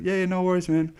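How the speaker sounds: high, breezy imitated reassurance; easygoing driver. Uber driver reassures passenger about the flight, breezily high.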